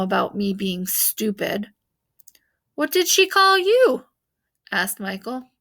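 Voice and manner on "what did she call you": put-on higher, louder curious boy; eager kid question. A narrator's reading. Michael asking grandpa about his nickname, higher and louder. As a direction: pipe up eagerly.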